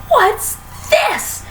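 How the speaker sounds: high startled gasp; a shocked high-pitched gasp. A narrator's reading. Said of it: "gasped Mister Twit".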